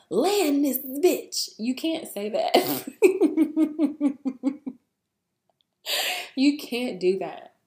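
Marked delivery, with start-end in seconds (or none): 0.1-1.5 slow, loud, higher put-on voice demanding the plane land; hammed-up order to the pilot, drawn out and loud; mock demand. Passenger joking she'd demand the plane land, slow loud mock voice. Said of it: "you can't just get up and be like". 2.6-4.7 loud laugh. Real, not acted. Laughing at own joke.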